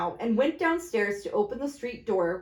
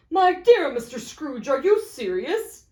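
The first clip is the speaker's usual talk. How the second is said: louder, raised astonished plea; can hardly believe it; astonished gentleman; 4 semitones higher, 6 dB louder than usual. Acted.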